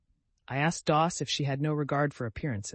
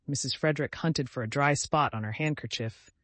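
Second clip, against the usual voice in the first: plain reading voice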